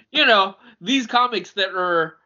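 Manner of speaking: talking through a laugh; words broken by laughter. Real, not acted. Laughing after listing comic titles.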